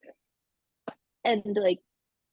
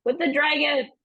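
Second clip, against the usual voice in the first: tearful distress; 5 semitones higher than usual. Acted. Nixie sobbing out her story.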